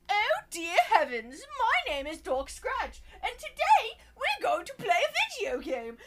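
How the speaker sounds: high, silly British character voice; mock British voice. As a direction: put on a prim, high-pitched British accent. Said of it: "He is British".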